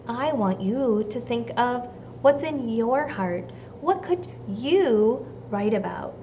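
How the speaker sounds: ordinary delivery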